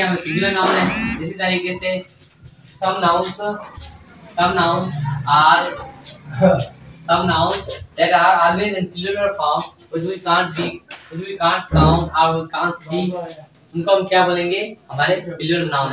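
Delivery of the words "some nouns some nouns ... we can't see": very slow, word by word, like dictating notes; slow English dictation to the class. Shown as a demonstration. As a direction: spell it out slowly so students can write. Teacher dictating English definition.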